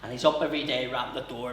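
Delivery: normal talking voice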